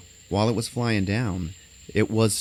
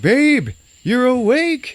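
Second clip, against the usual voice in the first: much higher and louder, slow, doting; gushing husband; 10 dB louder, much slower than usual. Retold or imitated. Friend retelling a movie, voicing the doting husband. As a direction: coo it like an overjoyed husband. Husband voiced in retold scene.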